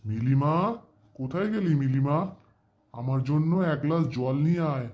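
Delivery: high, loud call across the house. Acted. Uncle calling Milima to bring water, calling out. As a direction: call out for someone out of sight.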